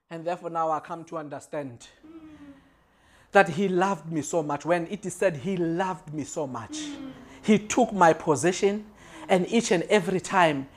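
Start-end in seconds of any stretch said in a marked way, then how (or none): none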